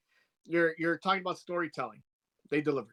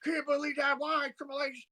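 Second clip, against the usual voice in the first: J R impression; 9 semitones higher than usual. S0 imitates the announcer losing it over Stone Cold. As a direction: do the frantic wrestling announcer. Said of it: "who's like".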